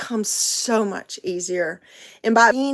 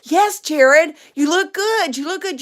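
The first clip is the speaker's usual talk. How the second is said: high, weary-wife imitation; mock exasperated reassurance; 6 semitones higher, 4 dB louder than usual. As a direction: reassure him with worn-out patience. Acted wife reassures insistently.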